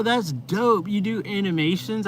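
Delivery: ordinary talk, nothing marked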